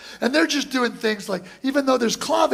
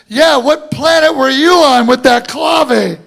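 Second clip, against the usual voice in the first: louder, slow mocking jab; teasing shout; 3 semitones higher, 11 dB louder than usual. [name] teasing the drummer a year later, slow and louder. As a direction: rib him loudly and slowly.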